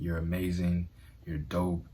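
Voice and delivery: usual speaking voice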